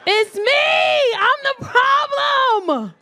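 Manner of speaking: high, drawn-out wail of admission; loud, hammed-up self-blame; comic confession shout. Shown as a demonstration. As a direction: belt out the confession, stretching the last word.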